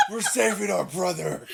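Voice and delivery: laughing. Real, not acted. Friend jokes about the brothers, laughing. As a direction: laugh out the line.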